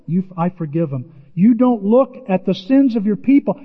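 ordinary talk

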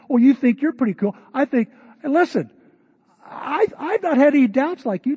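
high, loud mocking jab; bickering disciple. Disciple taunting Tom at supper, high and mocking. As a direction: needle him, sneering. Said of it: "The bickering begins".

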